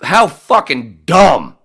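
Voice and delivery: loud, slow disgusted outburst; exasperated. Real, not acted. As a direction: spit it out slowly in disgust.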